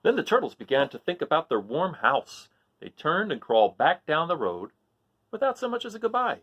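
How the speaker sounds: lively read-aloud storytelling; story narration. A narrator's reading. Teacher reading a story to the class, lively narration. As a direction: read it like a picture book to kids. Reads a picture book aloud.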